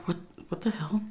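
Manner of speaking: whispered confusion; hushed what-the-hell under her breath. Retold or imitated. Caregiver at the front door hearing voices, whispering in confusion. Hears talking behind the door.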